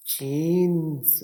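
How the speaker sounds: जीन्स stretched slowly with a final z sound; drawn-out model word. Shown as a demonstration. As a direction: say जीन्स slowly, ending on a buzzing z. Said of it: "एस ज की आवाज़ निकाल रहा है".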